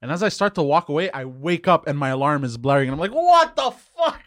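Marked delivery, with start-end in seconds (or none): 3.1-4.2 very high, drawn-out mock scream; loud, stretched-out comic freak-out; startled yell. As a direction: shriek it slowly, as if jolted awake. Wakes to a blaring alarm.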